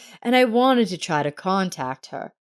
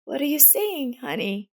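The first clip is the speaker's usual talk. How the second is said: tender; 8 semitones higher, 4 dB quieter than usual. A narrator's reading. Grandmother comforting girl.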